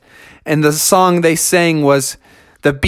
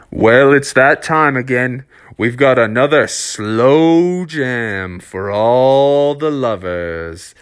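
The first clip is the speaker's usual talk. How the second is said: DJ voice; 4 semitones lower, slower than usual. A narrator's reading. DJ announces slow jam.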